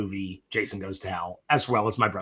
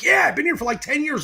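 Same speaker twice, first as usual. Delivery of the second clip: friend's casual reply; 4 dB louder than usual. Retold or imitated. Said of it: "He's like".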